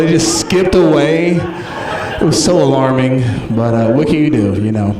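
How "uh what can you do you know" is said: ordinary talk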